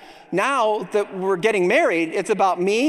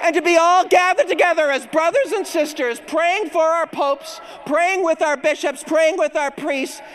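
higher, building toward the cheers; rousing preaching; 6 semitones higher than usual. Acted. Preacher rallies the congregation to cheer, rousing. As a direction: whip up the crowd with fervour.

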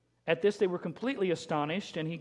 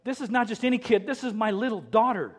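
louder, higher plea of a desperate father; pleading father; 6 semitones higher, 6 dB louder than usual. Retold or imitated. Jairus pleading with Jesus, louder desperate plea. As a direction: beg for your little girl's life, louder. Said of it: "Can't you just see him going".

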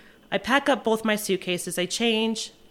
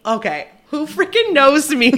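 high, loud startled question; bewildered, who on earth knows me; startled surprise; 8 semitones higher, 8 dB louder than usual. Retold or imitated. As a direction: caught off guard in the airport, high and loud. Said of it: "And I'm like".

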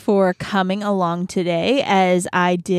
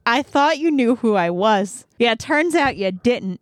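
ordinary talk

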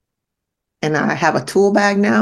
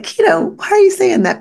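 much higher, louder mock-shocked squeal; shocked people; 3 dB louder than usual. Mocking people who ask why she says life sucks, high shocked squeal. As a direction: play the scandalized listener, high and loud. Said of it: "People are like".

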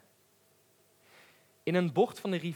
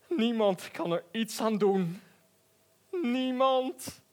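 sad lion; 10 semitones higher than usual. A narrator's reading. Lion consoles the cricket.

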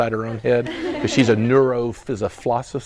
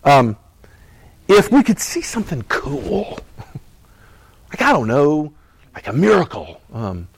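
ordinary talk, nothing marked

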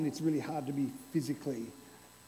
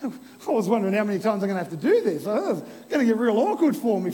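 laughing; 4 semitones higher, 12 dB louder than usual. Real, not acted. Laughs as volunteer returns.

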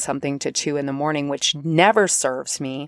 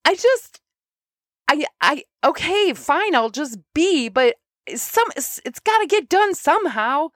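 much higher, whiny pleading voice; exasperated plea to spirit guides; 10 semitones higher than usual. Retold or imitated. Talking to her spirit guides, high exasperated whine. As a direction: beg the spirit guides, frazzled. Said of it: "I said".

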